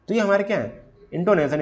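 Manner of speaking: normal talking voice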